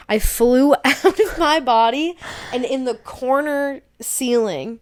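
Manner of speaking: can't believe it happened; laughing in disbelief. Real, not acted. Out-of-body experience.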